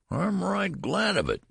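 high mock whine of an old man; whining old man. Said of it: "whined the old man".

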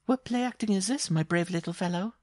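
very high, teasing woman's voice; amused giantess. Acted. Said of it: "she asked".

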